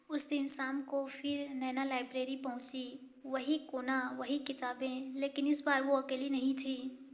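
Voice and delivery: plain reading voice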